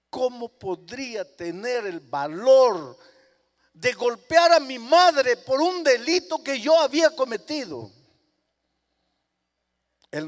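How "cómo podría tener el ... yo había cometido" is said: preacher's loud, aching outcry; impassioned preaching. Acted. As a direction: cry out his shame to the crowd. Preacher cries out the boy's anguish.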